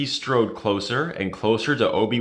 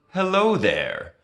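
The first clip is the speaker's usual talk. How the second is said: smiling hello, pitched up and slow; a lightly voiced Jedi greeting, smiling; Obi-Wan with a smile; 8 semitones higher, slower than usual. A narrator's reading. Obi-Wan drops down in front of Grievous, smiling greeting. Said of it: "Obi-Wan said with a smile".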